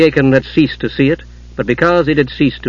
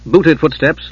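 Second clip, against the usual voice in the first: plain reading voice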